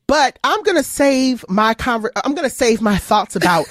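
ordinary delivery

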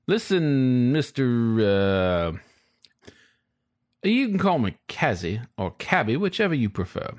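a hint of a folksy old cabbie; easygoing old driver, just a touch of character; lightly voiced old driver. Driver introduces himself.

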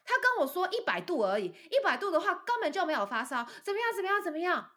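high, dismissive mom voice; mock dismissive mother. Mother brushing off the fever to the boss, high dismissive voice. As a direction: wave it off like the mom. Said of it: "他就说".

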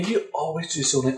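ordinary talk

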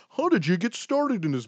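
high, mock-bored interviewer voice; mocking bland interviewer. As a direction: parody a dull stock question. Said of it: "I don't wanna ask questions like".

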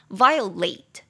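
"violate" with a full long "late" at the end; clear demonstration. Shown as a demonstration. Teacher contrasting confusable words, "violate" ending in full "late". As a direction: draw out the last syllable of "violate" as "late". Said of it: "差别就在最后那个".

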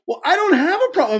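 high-pitched mock protest; defensive protest. Actor denying a problem to director, defensive squeal. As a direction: squeak out a hammed-up denial.

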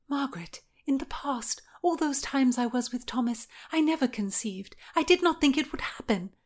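high, plaintive, partly hushed voice; defensive Anne. Acted. Anne excuses her pregnancy to Margaret, plaintive.

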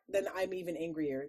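normal talking voice

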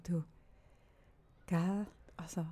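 hushed, confiding whisper to the ex-husband; slow, secretive whispered reassurance; retold whisper. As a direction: lean in and whisper it.

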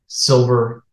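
ordinary talk

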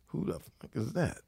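mock quiet menace, voice lifted; hushed retold question. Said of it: "got real quiet in it".

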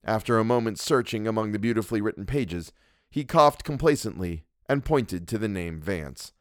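usual speaking voice

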